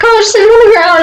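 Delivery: high, rushed imitation of a nervous neighbor; mock panicky neighbor. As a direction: blurt it high and fast, imitating someone keeping away. Retold panicky neighbor keeping her distance.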